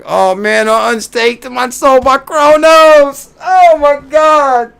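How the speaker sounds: high, slow, drawn-out wail of dismay; panicked wail. Retold or imitated. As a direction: cry out in mock despair. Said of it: "So I'm like".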